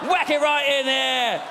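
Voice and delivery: loud, high yob shouting; chavvy English porn bloke. Retold or imitated. An English porn actor shouting at his partner, loud chav voice. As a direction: bellow it like a lairy chav. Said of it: "You watch English porn".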